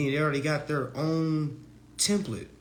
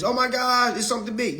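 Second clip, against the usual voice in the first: mock panic; 10 semitones higher, 7 dB louder than usual. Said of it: "you'll be thinking it's".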